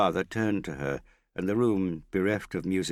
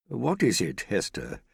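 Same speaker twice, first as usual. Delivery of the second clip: threatening tone; 5 semitones higher, 3 dB louder than usual. A narrator's reading. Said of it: "her father demanded in a threatening tone".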